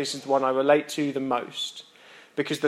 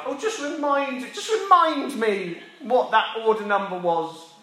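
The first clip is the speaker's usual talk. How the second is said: a lightly voiced server, higher and slow, bluffing casually; feigned casual server; 7 semitones higher than usual. Server who forgot the order bluffs casually, mildly mock-breezy. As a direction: cover the slip with a breezy question. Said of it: "they say something like".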